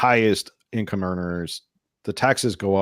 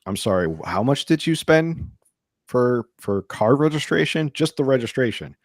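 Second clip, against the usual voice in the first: choked disbelief; 5 semitones higher than usual. Retold or imitated. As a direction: imitate someone stunned by the cost. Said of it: "they almost, like, choked".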